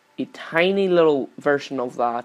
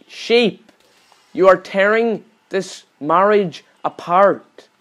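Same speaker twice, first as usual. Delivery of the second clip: usual speaking voice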